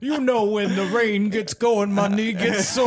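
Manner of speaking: high, put-on complaining voice; hammed-up grumble about aches; mock creaky-knee complainer. Host mocking own knee excuse, hammed-up complainer voice.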